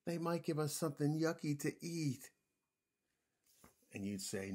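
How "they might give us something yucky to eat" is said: higher-pitched, fretful dinosaur voice; worried little dinosaur. A narrator's reading. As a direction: fret like a small dinosaur. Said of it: "in his worried little dinosaur voice".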